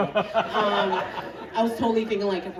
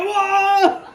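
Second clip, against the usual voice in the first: high, thrilled whoop; celebrating a wild night shift; delighted whoop; 10 semitones higher, slower than usual. Retold or imitated. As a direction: cheer like it's the best night. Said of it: "I'm like".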